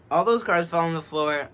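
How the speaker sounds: loud, high, caught off guard; surprised exclaim. Real, not acted. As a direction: blurt it as the cards drop. Cards fell, loud exclamation.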